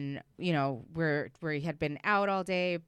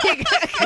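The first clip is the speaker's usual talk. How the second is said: high, loud burst of laughter; high embarrassed laugh; 12 dB louder than usual. Real, not acted. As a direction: laugh it off, mortified. Embarrassed at own story.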